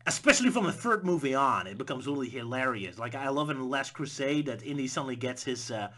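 ordinary delivery

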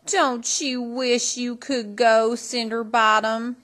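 slow, high, mocking taunt; sneering. A narrator's reading. Older stepsister taunting Cindy, sneering slowly. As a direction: draw it out to needle her. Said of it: "sneered the older sister".

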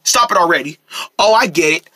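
ordinary talk, nothing marked

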